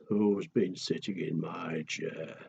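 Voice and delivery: low, slow, gruff daddy bear; big papa bear rumbling his question; a soft, lightly voiced daddy bear. A narrator's reading. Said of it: "daddy bear says".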